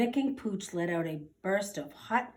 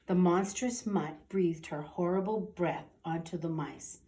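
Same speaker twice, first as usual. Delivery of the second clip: ordinary delivery, nothing marked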